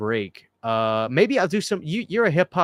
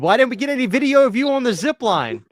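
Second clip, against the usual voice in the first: excited; 9 semitones higher, 4 dB louder than usual. Real, not acted. Excited question about zip line.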